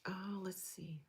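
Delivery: whispered aside to self; barely audible, whispering while checking; under the breath. Real, not acted. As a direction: whisper it to yourself. Murmuring to self while reading chat.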